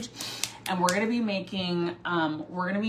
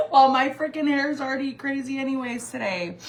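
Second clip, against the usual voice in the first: laughing; 6 semitones higher, 7 dB louder than usual. Real, not acted. Laughing at her smeared makeup.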